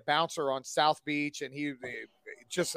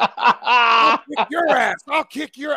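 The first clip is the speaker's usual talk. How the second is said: put-on belligerent drunk; 5 semitones higher, 9 dB louder, much slower than usual. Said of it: "Rob's trying to fight".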